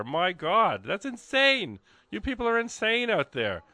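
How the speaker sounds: giddy, high-pitched marvelling; amazed excitement. Real, not acted. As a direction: gush at the listeners' generosity. Reacting to the pledge total.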